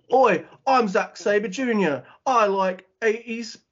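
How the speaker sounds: put-on awful English accent. Co-host imitates a wrestler mocking his rival's promo, awful English accent. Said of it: "it is an awful English accent".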